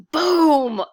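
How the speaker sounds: loud, high playful boom; mock explosion. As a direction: blast it out like an explosion. Sue blasted skyward.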